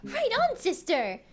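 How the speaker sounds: put-on high, loud kid's cheer; excited cheer. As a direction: burst out high and proud, then drop. Acted cheer of game character.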